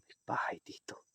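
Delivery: hushed whisper. Real, not acted. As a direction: whisper so nobody hears. Same words whispered.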